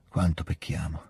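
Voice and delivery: subdued, slowing confession; sincere weakness. A narrator's reading. Shaken prince confiding in Pierre, weak and sincere. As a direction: confess it weakly, slowing toward the end. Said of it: "una sincerità e una debolezza".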